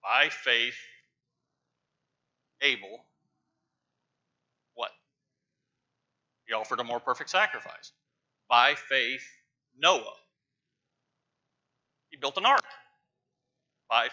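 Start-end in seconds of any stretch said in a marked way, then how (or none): none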